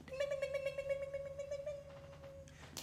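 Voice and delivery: very high, soft chiming dings, slowing at the end; bus bell dings. Retold or imitated. The number three seventy-eight bus arriving, high chiming bell. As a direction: ring it like a tram bell.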